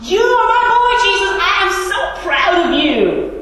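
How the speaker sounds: loud, high, booming proclamation of fatherly pride; God's proud declaration. Acted. God to Jesus before him, proud loud declaration. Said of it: "the declaration by God".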